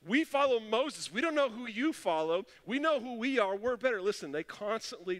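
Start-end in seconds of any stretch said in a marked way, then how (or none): 0.1-4.0 high, louder, self-satisfied boast; smug Pharisees. Acted. Preacher voicing the Pharisees boasting they follow Moses, in a high, smug voice. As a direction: puff up and look down on him. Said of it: "They're like".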